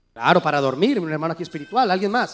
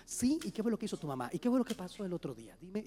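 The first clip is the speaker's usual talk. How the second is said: soft and much quieter, unhurried and gentle; calm hushed talk; 5 semitones lower, 20 dB quieter, faster than usual. Pretend. Couple talking over a problem at a restaurant, hushed and calm. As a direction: keep it down, like a private chat at a fine table. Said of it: "mira cómo se comunican, no levantan la voz".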